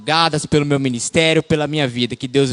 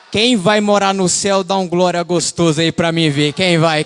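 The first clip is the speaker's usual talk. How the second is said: normal talking voice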